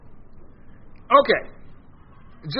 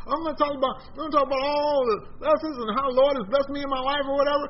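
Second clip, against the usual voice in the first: mock inmate; 8 semitones higher than usual. Inmate quoted in prison talk.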